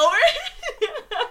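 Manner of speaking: laughing disbelief, high and loud; laughing squeal. Real, not acted. Laughing mock reaction.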